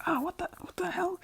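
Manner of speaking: high, whispery mock yell; Bakugo alarmed. Bakugo finds his arm switched, whispery alarm. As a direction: gasp it out in strained disbelief.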